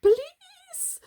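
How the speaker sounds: very high, loud shriek. A narrator's reading. Robbers shrieking as police appear. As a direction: shriek in terror. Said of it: "they shrieked".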